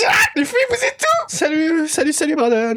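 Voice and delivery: jittery high-pitched greeting; nervous calling out. Real, not acted. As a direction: call out to hide the fear.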